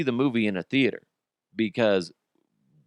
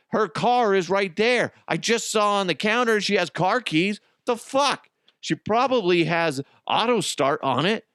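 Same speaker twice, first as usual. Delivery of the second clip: exasperated rant; 8 semitones higher than usual. Acted. Ranting at movie character.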